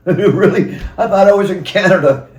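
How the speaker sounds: laughing through the words. Real, not acted. Amused at finding he was in Washington, laughing. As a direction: chuckle at your own mix-up. Laughs at his own mistake.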